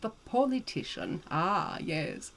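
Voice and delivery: put-on grand old-world lady. An ageless storyteller chatting to her darlings, grand and old-fashioned.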